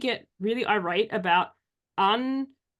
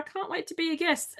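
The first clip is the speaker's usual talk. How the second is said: high, softer fawning fan imitation; put-on eagerness, pitched up; mock gushing; 6 semitones higher, 5 dB quieter than usual. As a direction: imitate a flattering would-be guest. Mimicking fake flattering guest pitches.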